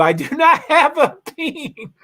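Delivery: laughing embarrassed. Real, not acted. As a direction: giggle out the reassurance, a bit slow. Awkward joke about gender.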